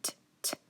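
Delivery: "t" as tiny voiceless taps; soft isolated "t", no vowel; T sound. Shown as a demonstration. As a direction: sound out "t" twice, apart. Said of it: "I hear that er".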